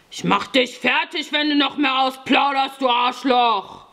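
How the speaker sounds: high, threatening scolding; angry cursing. A narrator's reading. Fjodor threatens the boy for blabbing, angry cursing. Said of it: "schimpfte Fjodor".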